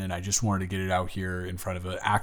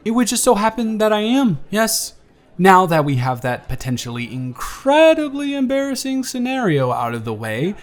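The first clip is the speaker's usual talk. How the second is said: put-on friendly, teasing young man on a first date, livelier and louder than the intro; charming blind date; 7 semitones higher, 9 dB louder than usual.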